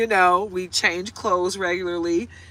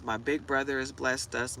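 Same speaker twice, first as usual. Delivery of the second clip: ordinary talk, nothing marked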